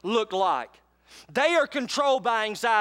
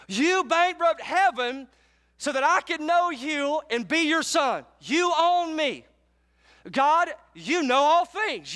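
spoken prayer; 5 semitones higher than usual. Acted. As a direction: pray it out slowly with full force.

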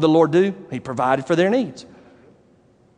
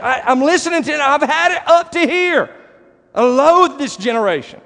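fed-up Lord; 9 semitones higher than usual. Retold or imitated. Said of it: "He's like".

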